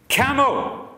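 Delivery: a sudden high yell of Camel!; shouted. Retold or imitated. Parched man, voice back, yelling for his camel. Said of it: "he shouted".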